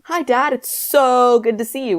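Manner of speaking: "so" stretched long, high-pitched; high greeting with a long, long "so"; drawn-out SO. Acted. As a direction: gush it, dragging out "so". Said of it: "with five O's".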